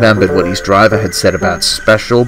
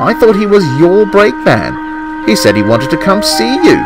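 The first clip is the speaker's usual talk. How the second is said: higher, puzzled engine voice; confused; 9 semitones higher than usual. Acted. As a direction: plead confusion with pitch raised. Confused Arthur defends himself to twins.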